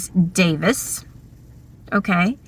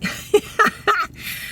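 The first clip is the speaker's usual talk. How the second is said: breathy laugh; 11 semitones higher, 6 dB quieter than usual. Retold or imitated. Class reacting to exchange.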